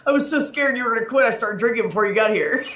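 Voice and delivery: retold relief, pitched high; relieved outburst, lightly acted; mock relieved confession. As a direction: imitate a friend gushing with relief, high.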